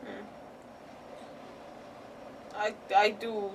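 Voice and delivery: ordinary delivery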